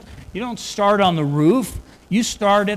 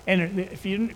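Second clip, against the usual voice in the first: ordinary delivery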